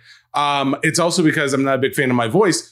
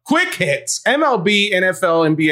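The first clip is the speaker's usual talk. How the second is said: high announcer call; 5 semitones higher than usual. Acted. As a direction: sell the segment title like a host. Announcing show segment.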